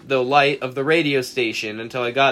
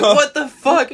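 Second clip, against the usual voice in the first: high, loud retold shout of shock; shocked yell; 13 semitones higher, 7 dB louder, slower than usual. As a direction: yell it high and loud, half laughing at the memory. Finding bleeding friend at door.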